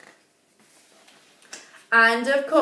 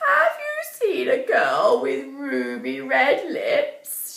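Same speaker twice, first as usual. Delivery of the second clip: put-on old woman voice; 5 semitones higher than usual.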